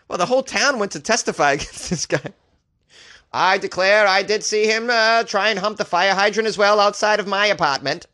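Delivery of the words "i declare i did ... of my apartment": higher-pitched put-on voice of a declaring witness; hammed-up witness. Townsperson testifying about the fire hydrant, hammed-up witness voice. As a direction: declare it grandly to the court. Acts out yet another town witness.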